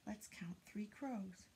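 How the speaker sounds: whispered invitation; soft whisper. Acted. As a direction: hush it like a secret.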